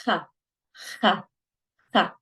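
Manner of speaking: "kha" with a rasped back-of-mouth fricative; Arabic kha. Shown as a demonstration. Teacher recaps sounds to practice, Arabic kha. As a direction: repeat "kha" as isolated practice syllables. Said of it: "sounds that you need to practice".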